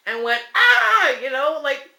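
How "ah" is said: short sharp high scream; a startled shriek; retold scream. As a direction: yelp it out in fright. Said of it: "I kind of screamed".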